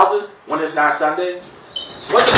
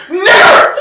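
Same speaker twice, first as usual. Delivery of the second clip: high, loud hollered slur; a high jeering yell; mock yelled taunt; 13 semitones higher, 8 dB louder than usual. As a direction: shout it from a passing car. Taunt shouted again.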